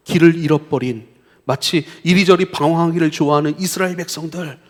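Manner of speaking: ordinary delivery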